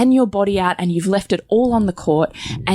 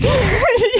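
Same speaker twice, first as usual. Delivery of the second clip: bright laugh much higher; high burst of laughter. Real, not acted. Wife laughing at her own defiant rage. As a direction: laugh at your own boldness.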